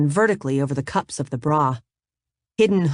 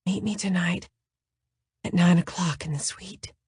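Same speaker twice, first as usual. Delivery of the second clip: soft, breathy, seductive voice; breathy, sexy; 2 semitones higher, 5 dB quieter than usual. Acted. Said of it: "but breathier, sexier".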